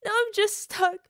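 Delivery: high, loud sobbing wail. Acted. Partner sobbing that only her lover brings her ease, wailing. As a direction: wail it through tears.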